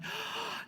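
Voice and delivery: sharp high gasp of wonder; amazed gasp. Retold or imitated. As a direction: gasp as if the mind is blown. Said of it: "I'm like".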